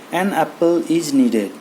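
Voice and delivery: An apple is needed said very slowly; slow example sentence. Shown as a demonstration. Teacher giving students an example very slowly. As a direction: dictate the example word by word. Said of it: "ᱚᱱᱟ ᱠᱷᱟᱹᱛᱤᱨ ᱛᱮ".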